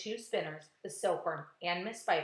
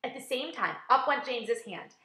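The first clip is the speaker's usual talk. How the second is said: plain reading voice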